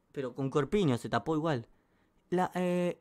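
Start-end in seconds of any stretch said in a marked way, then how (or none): none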